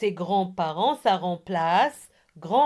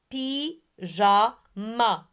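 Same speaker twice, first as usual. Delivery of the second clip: PYJAMA slowly, split py-ja-ma; three separate beats on "Pyjama"; syllable by syllable; much slower than usual. Shown as a demonstration. Teacher has pupils repeat Pyjama, syllable by syllable. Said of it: "Ce sont les nombres de syllabes".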